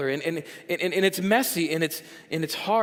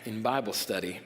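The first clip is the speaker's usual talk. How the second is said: lower, slow smooth flirt; cheesy pickup-line voice; 7 semitones lower than usual. Pretend. Pastor mocking a Bible-study pickup line, slow lower cheesy croon. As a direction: lay it on thick like a sleazy charmer. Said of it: "I do this voice when I talk like this".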